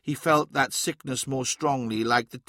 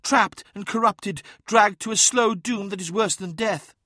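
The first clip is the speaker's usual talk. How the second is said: high, strained, anguished seer; gasped; 8 semitones higher than usual. A narrator's reading. Uthizar tells of the trapped souls' doom, gasped and high. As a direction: draw out the horror in a high voice. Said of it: "gasped Uthizar".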